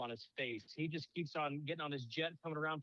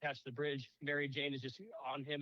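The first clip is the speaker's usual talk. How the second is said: usual speaking voice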